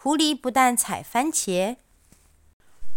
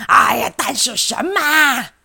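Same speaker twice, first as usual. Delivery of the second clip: loud, exasperated fox; impatient; 4 semitones higher, 7 dB louder than usual. Acted. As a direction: sound fed up with the delay.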